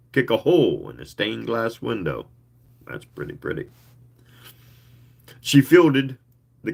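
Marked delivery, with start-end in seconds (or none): 2.9-3.7 whispered comment to yourself; whispered aside. Real, not acted. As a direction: breathe it, impressed. Reader's unvoiced aside.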